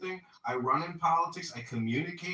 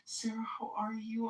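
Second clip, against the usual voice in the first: breathy whispered fuss, higher pitch; mock concern, whispered; 7 semitones higher, 6 dB quieter than usual. Hotel group repeating to Sarah in a whispered mock concern. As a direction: whisper the worry like a gossip. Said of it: "everybody's like".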